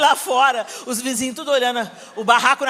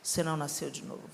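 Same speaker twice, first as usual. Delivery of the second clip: lower, much softer haunting echo; eco na cabeça; 10 semitones lower, 15 dB quieter, faster than usual. Retold or imitated. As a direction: murmur it like a voice in the mind. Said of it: "aquela fala ficou latejando na minha cabeça". (frase ecoando baixinho, lenta e grave)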